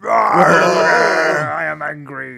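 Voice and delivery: slow booming angry growl; mock angry god. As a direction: boom it out, hammed up. Mock Kratos family scene.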